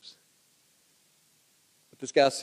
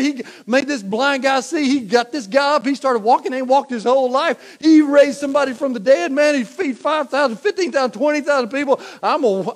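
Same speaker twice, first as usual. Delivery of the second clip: higher, eager hype-man voice; mock excited follower; 9 semitones higher, 8 dB louder than usual. Fickle follower hyping Jesus's miracles, mock excited. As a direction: gush about the miracles like a fanboy.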